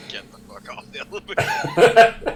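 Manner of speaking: high, very loud mock outburst; laughing shout. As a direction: yell it out through laughter. Player jokingly orders butler off elevator.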